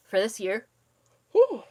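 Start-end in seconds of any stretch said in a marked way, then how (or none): 1.3-1.6 loud sighing yawn. Real, not acted. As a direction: let out a big yawn. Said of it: "Sorry, guys, um, for yawning".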